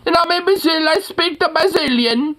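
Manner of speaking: high, dopey know-it-all voice; mock tourist. Parodying a clueless tourist, high and dopey. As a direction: play a clueless tourist bragging. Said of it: "like, man".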